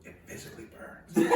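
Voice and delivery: unvoiced, laughing in pain; breathless laugh. Real, not acted. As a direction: gasp it out through the burn. After drinking sour shot.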